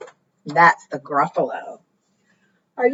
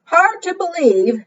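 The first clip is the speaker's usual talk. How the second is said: disbelieving; 10 semitones higher than usual. Acted. Gruffalo doubts the mouse.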